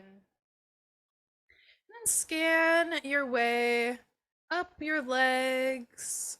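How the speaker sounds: unhurried and settling, guide them gently inward; guided meditation. Acted. Yoga teacher leading students through a body scan, guided meditation.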